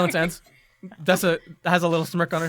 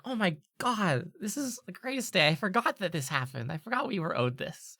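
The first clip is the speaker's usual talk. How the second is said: soft, higher-pitched delighted student; Veldin, pleasantly amazed; 4 semitones higher, 5 dB quieter than usual. Acted. Student receives long-forgotten snacks he was owed, softly delighted. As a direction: marvel softly at an unexpected treat.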